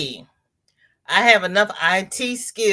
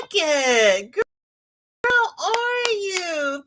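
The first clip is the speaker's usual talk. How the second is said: sing-song greeting; 11 semitones higher, slower than usual. Real, not acted. Greeting a viewer.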